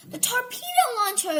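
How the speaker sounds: high, alarmed yell; panicked captain's shout. A narrator's reading. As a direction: cry out in alarm. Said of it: "yelled the captain".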